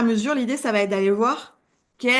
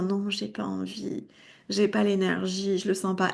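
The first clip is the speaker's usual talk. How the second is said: reluctant whine; 6 dB quieter than usual. Pretend. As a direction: drag it out like you can't be bothered. Voicing the reluctant nervous system.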